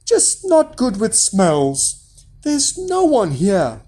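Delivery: high, slow wife voice; wife gently putting him off; giant's wife. A narrator's reading. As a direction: soothe the giant, pitched high. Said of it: "said his wife".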